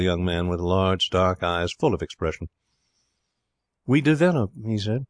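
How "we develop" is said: higher, lofty pronouncement; affected poet. A narrator's reading. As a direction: declare it as a precious poet. Voices the poet.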